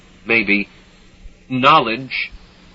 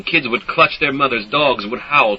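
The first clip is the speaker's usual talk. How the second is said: plain reading voice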